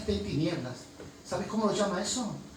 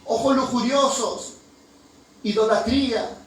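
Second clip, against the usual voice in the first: lista de pecados lanzada alto y fuerte; predicación a gritos; 5 semitones higher, 7 dB louder than usual. Acted. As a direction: clama cada pecado a la congregación. Predicador enumera pecados gritando. (grita la lista de pecados con fuerza)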